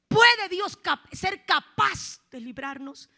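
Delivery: booming question to the congregation; loud. Real, not acted. Preacher booming a challenge to his listeners, loud. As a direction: thunder the question out slowly.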